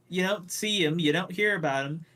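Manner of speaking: ordinary talk, nothing marked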